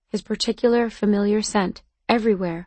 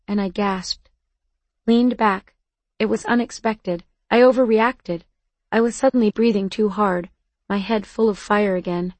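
ordinary delivery

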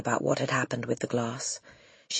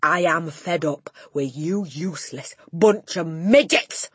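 roaring; 2 semitones higher, 7 dB louder than usual. Acted. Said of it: "roared the Trunchbull".

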